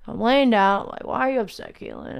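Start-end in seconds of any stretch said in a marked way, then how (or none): 1.0-2.2 quick, offhand self-questioning; not taking it seriously. Retold or imitated. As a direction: ask yourself as a half-hearted joke. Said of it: "I'm taking it as a joke".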